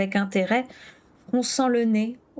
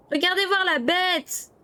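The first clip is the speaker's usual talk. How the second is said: higher, slow mock call to the crowd; shouting boy; 9 semitones higher than usual. A narrator's reading. Matt calls to the crowd from the shoulders, lightly yelled. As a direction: call out proudly from on high. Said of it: "criait-il".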